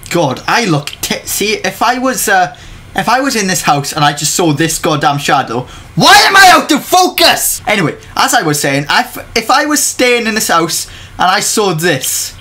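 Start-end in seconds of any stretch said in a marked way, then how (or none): 5.9-7.6 sudden high, loud complaint; exasperated outburst. Real, not acted. Vlogger notices the camera is unfocused, exasperated shout. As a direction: break off and shout it in indignation.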